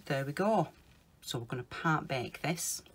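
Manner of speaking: ordinary delivery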